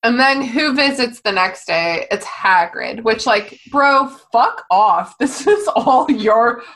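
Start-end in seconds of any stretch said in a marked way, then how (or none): 3.7-6.6 slow, drawn-out scold; mock scolding. Friend scolding Hagrid, slow drawn-out mock blame. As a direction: stretch every word at him.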